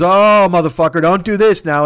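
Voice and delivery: hammed-up friend losing patience at grocery questions; exasperated outburst. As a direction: blow up at the pestering question. Friend snaps back on the phone.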